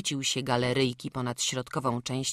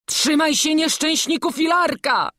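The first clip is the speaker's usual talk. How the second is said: urgent, raised call to someone high up; alarmed call; 13 semitones higher, 10 dB louder than usual. Acted. Master warns the boy on the ledge, alarmed call. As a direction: call up a frightened warning.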